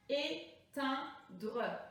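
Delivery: "Éteindre" in slow, separated syllables; découpage syllabique. Shown as a demonstration. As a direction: break "Éteindre" up for counting. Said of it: "Combien de syllabes?". (« Éteindre » lentement, syllabe par syllabe)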